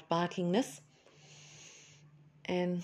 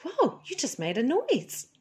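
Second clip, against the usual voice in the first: higher, amazed whoa; surprised; 7 semitones higher than usual. Retold or imitated. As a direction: gasp at the dog's first sound. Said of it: "I was like".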